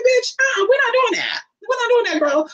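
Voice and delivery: very high-pitched, sassy objection; indignant refusal. Retold or imitated. Telling sister off in a retold argument, indignant. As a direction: shut it down in a high squeal.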